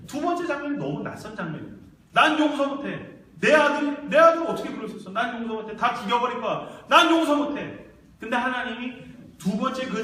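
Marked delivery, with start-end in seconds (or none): 2.1-7.9 raised, high-pitched outburst of a bereaved father; acted vengeful anguish; grief-stricken fury. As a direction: cry out in rage and pain. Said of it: "이 고통을 어떻게 감당하지 못해서".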